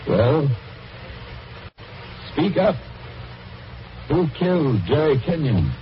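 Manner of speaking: high, straining plea to the record; pressing the voice to answer; urgent demand. Acted. Old man pleading with the ghostly record, urgent.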